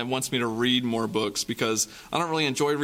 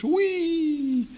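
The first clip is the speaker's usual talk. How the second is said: squealed very high whee of delight; fly up on a gleeful high whee; joyful whee. A narrator's reading. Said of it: "swung out of their reach".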